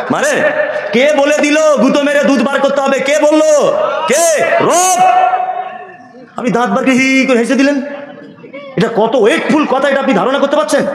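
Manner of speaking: fervent sermon cry; preaching at full force. Acted. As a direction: drive each question home to the congregation. Preaching call and response.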